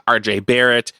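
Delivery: ordinary talk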